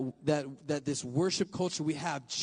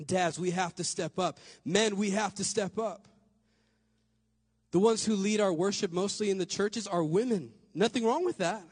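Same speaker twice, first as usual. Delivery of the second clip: loud, rising preacher's call; fired-up preaching voice; preaching at full force; 6 semitones higher, 4 dB louder than usual. Real, not acted. Preaching to the fathers in church.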